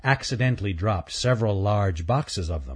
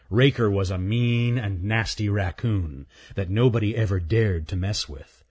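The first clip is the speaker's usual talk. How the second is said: ordinary delivery, nothing marked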